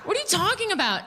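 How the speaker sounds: normal talking voice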